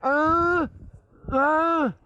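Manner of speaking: high-pitched, petulant outburst; mock-angry, high exclamation; huffy yell. Acted quarrel with assistant.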